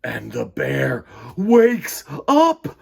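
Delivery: slow, swelling picture-book read; reading to children. A narrator's reading. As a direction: read it slowly, building the bear's fury. Storybook narration, bear waking.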